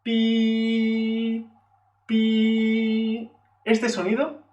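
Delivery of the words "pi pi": high, sustained imitation train whistle; long toot like a train. Shown as a demonstration. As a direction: hold "pi" on one high, level pitch. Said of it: "este sonido alto y mantenido".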